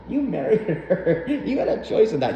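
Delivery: laughing through the words; amused laughing tease. Real, not acted. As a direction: crack up while teasing the stepdad. Laughing at stepdad's choice.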